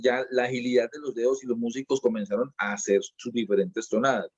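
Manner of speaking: usual speaking voice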